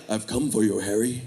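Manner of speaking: low, soft villain voice; a hint of a menacing dark lord; Voldemort voice. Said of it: "Voldemort out at night ... like".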